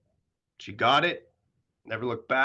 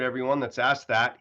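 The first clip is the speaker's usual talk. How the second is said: ordinary talk, nothing marked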